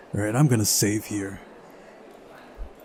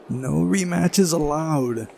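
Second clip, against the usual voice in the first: higher, drawn-out ruling; official referee character; 5 semitones higher, much slower than usual. Acted. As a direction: declare it slowly like an official. Voicing Electronic forbidding a rematch.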